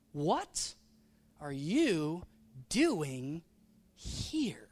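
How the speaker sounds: every word isolated with pauses; slow, deliberate question, each word swooping; word-by-word stress. Acted. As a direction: spell out the question one emphasized word at a time. God's question put to the congregation.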